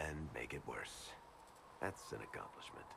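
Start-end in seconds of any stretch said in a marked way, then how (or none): 1.8-2.9 a sardonic aside, breathed out unvoiced; dry whisper. Advisor mutters darkly to the Inquisitor, dry whisper. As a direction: whisper it under your breath, dryly. Dry remark on the Elder One.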